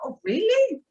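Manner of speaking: high mock surprise; surprised. Reacting to fans loving her accent, high mock surprise. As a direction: jump up high like a flattered surprise. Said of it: "And I'm like".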